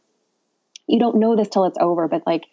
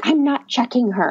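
high-pitched, huffy mock refusal; retold dramatic refusal; 6 semitones higher than usual. Doctor getting up to quit the exam, retold dramatic refusal. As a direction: imitate someone dramatically refusing. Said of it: "said it was quite dramatic".

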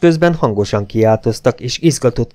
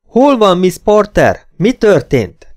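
high, loud, insistent questions; urgent questioning; 10 semitones higher, 5 dB louder, slower than usual. A narrator's reading. Clayton questioning Esmeralda, urgent and loud. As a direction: demand news of the missing girl.

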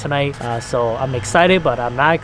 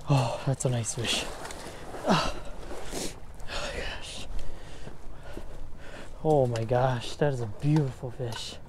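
awed whisper; 8 dB quieter than usual. Real, not acted.

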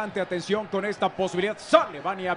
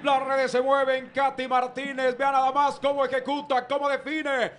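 high, excited goal recap; breakneck goal commentary; 6 semitones higher than usual. Real, not acted. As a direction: keep the goal buzz going.

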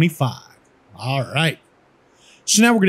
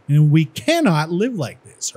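slow, with "cannot" pressed hard; stress on cannot; slower than usual. Shown as a demonstration. Demonstrating emphasis for the lesson, slow with "cannot" stressed. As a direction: drag it out and hit "cannot". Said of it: "with an emphasis using verbal stress".

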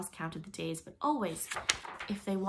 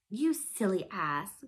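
slow, louder, odd-voiced insult; passionate outburst; 5 dB louder, much slower than usual. A narrator's reading. Tinker Bell to Peter, in a slow, louder passion. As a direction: spit it out in a fairy's fury. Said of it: "cried Tinker Bell in a passion".

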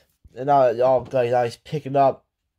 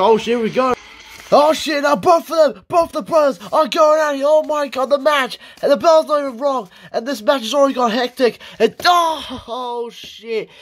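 excited shouting; 9 semitones higher than usual. Real, not acted. Player calling the brothers' brawl, high excited shouting. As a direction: yell it as the fight erupts.

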